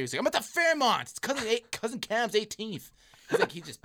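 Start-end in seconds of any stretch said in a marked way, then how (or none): 0.2-2.9 high, loud, slowing agitated answer. Retold or imitated. Injured friend snapping back at dumb questions, agitated. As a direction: snap back like an annoyed drunk. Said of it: "he's pissed".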